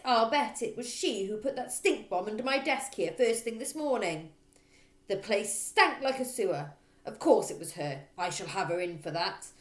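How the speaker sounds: loud barking rant; loud bossy headmistress. Acted. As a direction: hammer every line like a bully. Trunchbull ranting on.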